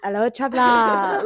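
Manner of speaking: imitating Trisha's tavern hello; mock innkeeper. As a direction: hail the traveller, hammed up.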